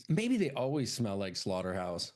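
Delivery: ordinary talk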